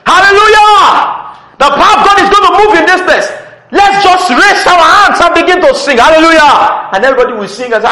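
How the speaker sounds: high, very loud rallying shout; full-force shouted acted hallelujah call; hype worship leader. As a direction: imitate a leader firing up the congregation. Acting out a worship leader hyping crowd.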